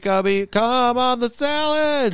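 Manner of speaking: high, slow pet-calling voice; sing-song calling. Pretend.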